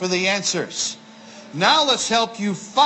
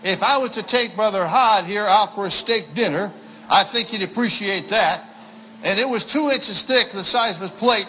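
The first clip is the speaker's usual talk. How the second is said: usual speaking voice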